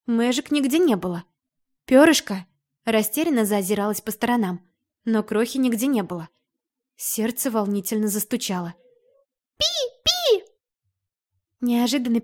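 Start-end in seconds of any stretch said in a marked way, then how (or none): 1.8-2.5 loud worried call. Acted. Calling for the missing bird. 9.6-10.4 very high chirp; high bird squeak. Acted. Muffled squeak from corridor.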